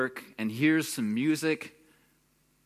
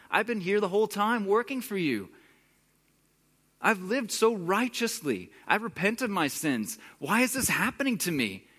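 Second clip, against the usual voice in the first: higher pleading, rising to a near-wail on fair; whining indignant plea; 6 semitones higher than usual. Retold or imitated. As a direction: sound wronged and self-righteous, pleading with God. Brother's complaint to God builds.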